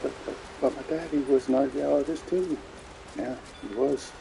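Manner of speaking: high, whiny imitation of crying; mock crying. Player mocks heroine crying over her daddy, mock crying. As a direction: stammer it out in a mock sob. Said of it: "Crying over her daddy".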